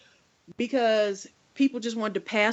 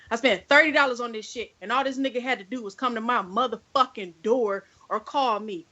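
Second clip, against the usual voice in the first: ordinary talk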